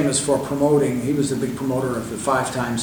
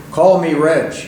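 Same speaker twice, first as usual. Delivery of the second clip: loud, higher, emphatic correction; 4 semitones higher, 6 dB louder, slower than usual. Retold or imitated. Reg meeting a fan in person, emphatic. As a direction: state it firmly and loudly. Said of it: "he's very emphatic".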